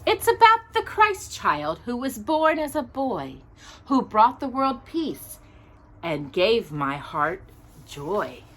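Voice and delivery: gentle rhyming storybook read; reading to children. Acted. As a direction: read the picture book aloud to little listeners. Reads a Christmas storybook aloud.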